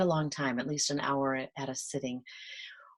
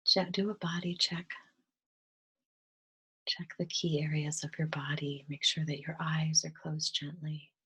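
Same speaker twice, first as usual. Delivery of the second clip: soft, calm meditation voice with whispered stretches; gentle guided meditation, drifting into whispers; 8 dB quieter than usual. Acted. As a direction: ease them into stillness, half-whispering.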